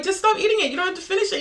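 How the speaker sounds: high-pitched plea. Retold or imitated. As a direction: beg him to quit, voice up high. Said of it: "And I'm like".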